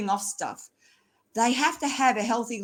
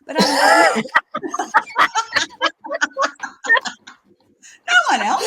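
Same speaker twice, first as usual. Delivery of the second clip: high, loud laugh through the words; giggling it out, louder and higher; 14 semitones higher, 9 dB louder than usual. Real, not acted. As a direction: crack up mid-joke at the ex. Joking about ex-husband.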